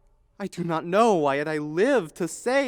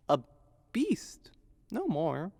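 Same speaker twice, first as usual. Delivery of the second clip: hushed, unvoiced whisper; bitter whisper; 2 semitones lower, 7 dB quieter than usual. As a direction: breathe it as a whisper. Hamlet's soliloquy drops to a whisper.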